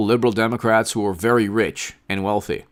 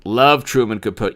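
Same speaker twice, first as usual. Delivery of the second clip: ordinary delivery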